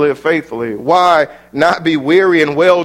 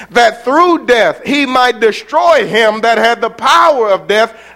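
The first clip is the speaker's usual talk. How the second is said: louder and higher, scripture thundered out to the church; full-force preaching; 9 semitones higher, 6 dB louder than usual. Acted. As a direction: build it up like a revival preacher, driving to the last line.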